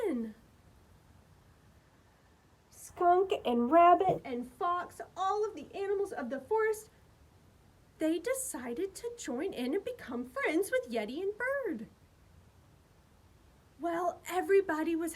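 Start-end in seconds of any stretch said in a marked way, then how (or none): none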